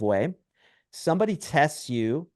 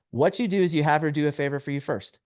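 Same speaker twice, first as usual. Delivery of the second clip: ordinary talk, nothing marked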